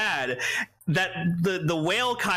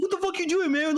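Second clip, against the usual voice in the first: much higher, a hammed-up rival's challenge; mock angry biker; 12 semitones higher, faster than usual. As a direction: throw it at him like a rider picking a fight. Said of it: "he's like".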